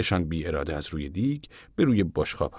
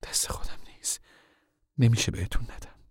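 torn, soft; 5 semitones higher, 4 dB quieter than usual. Acted. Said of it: "دو دل مانده بود".

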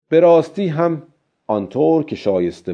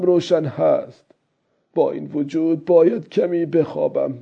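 put-on higher-pitched old fisherman, easing down toward the end; old man reasoning with himself; 6 semitones higher than usual.

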